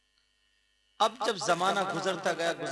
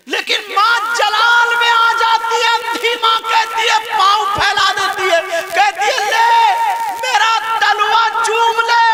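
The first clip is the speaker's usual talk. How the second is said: preaching at full force; 18 dB louder than usual. Acted. Preacher crying out the saint's story to the crowd, full force. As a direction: pour it out to the congregation.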